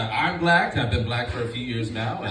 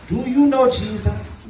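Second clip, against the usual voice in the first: grandma asking; 12 semitones higher, 6 dB louder than usual. Retold or imitated. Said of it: "She'd be like".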